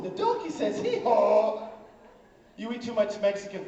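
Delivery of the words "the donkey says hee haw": talking toy book. Acted. Book in his pants talking mid-spanking, loud donkey bray.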